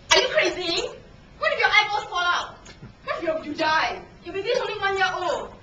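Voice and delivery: high, loud barrage of disbelief; alarmed colleagues' questions. Retold or imitated. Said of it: "series of questions thrown at me".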